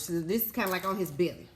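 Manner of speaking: ordinary talk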